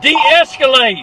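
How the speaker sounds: loud desperate shout; yelling. Real, not acted. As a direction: yell it at the officer. Screaming during arrest.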